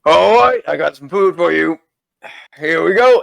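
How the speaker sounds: big booming call from the back room; loud voice. Acted. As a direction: call out loudly, high and hearty. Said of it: "a loud voice".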